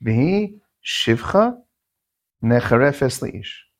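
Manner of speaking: slow pasuk reading. Shown as a demonstration. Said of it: "The pasuk says".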